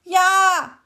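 shouted. A narrator's reading. Beetle shouting after the cricket. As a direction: yell it back. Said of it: "schrie der Käfer".

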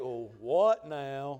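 low, slow, gloomy donkey sigh of a voice; Eeyore mode. Retold or imitated. Pastor hearing bad news, mopes like Eeyore. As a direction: drag it out like a defeated Eeyore. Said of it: "I went into total Eeyore mode".

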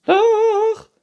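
very high, loud retort; princess calling out. A narrator's reading. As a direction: shriek back stubbornly as princess. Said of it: "rief die Prinzessin von oben". (trotziger, hoher Prinzessinnenschrei)

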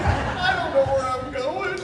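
high, slow, lost little voice; helpless whine. Retold or imitated. Lost driver answering the big stranger in a high whine. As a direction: plead it like a lost kid.

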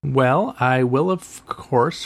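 normal talking voice

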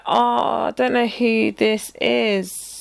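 very slow, dragged-out mock apology; drawn-out feigned ignorance. Replying to an unknown texter, drawn-out feigned ignorance. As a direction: slowly stretch out every word.

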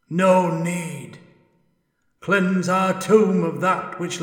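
high, loud, otherworldly ghost; an anguished spirit calling from beyond; ghostly spirit voice. Acted. Ghost pleads with the dwarf.

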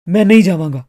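loud, angry refusal. A narrator's reading. Artist angrily resisting the young man pulling him out, loud. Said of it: "ਉਹ ਗੁੱਸੇ ਨਾਲ ਬੋਲਿਆ".

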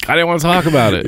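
high, quick amused protest; laughing protest. Real, not acted. Telling his friend he didn't want to talk, high and amused.

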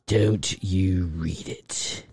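low, slow mock-English menace; bad English accent. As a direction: drag it out in a deep, badly faked English voice. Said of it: "as bad as David Jaffe's English impersonation".

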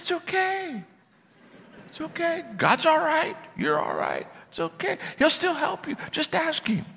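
very high, soft soothing voice; gentle reassurance. Retold or imitated. Coach soothing his agnostic colleague, tenderly reassuring. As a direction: coax him softly in a high, tender voice.

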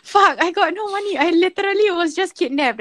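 mock-desperate, high-pitched plea; retold panicked protest. Kidnapped man protests to would-be robbers, retold panic. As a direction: act out his exasperated alarm, voice jumping up.

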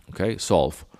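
talking between sips of coffee; words half into the coffee cup; sipping coffee. Real, not acted. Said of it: "Sorry, I'm just drinking some coffee here".

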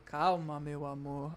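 soft, fading reassurance; soothing a child. Pretend. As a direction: hush him gently like a child. Calming the friend down. (acalmando como a uma criança)

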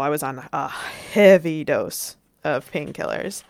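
ordinary delivery

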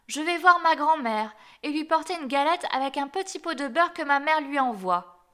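put-on little girl. A narrator's reading. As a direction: answer brightly pitched as a trusting child. The child answers the wolf.

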